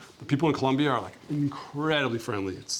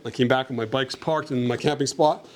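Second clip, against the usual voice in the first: ordinary delivery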